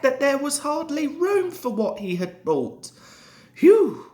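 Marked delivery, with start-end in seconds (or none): 3.5-4.1 high, loud whistling phew; whistled phew. A narrator's reading. Driver seeing the huge train, loud whistled phew. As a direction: blow out a big impressed whistle. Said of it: "whistled the driver".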